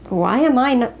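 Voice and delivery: ordinary delivery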